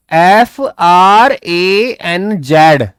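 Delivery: letter by letter, slow and clear; spelling out. Shown as a demonstration. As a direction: spell each letter separately and slowly. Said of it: "क्या spelling रहेगी उसकी?". (धीरे-धीरे एक-एक अक्षर बोलकर spelling)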